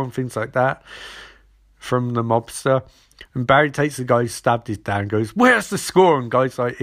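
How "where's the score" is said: high, loud mock interrogation; acted, forceful questioning; demanding shout.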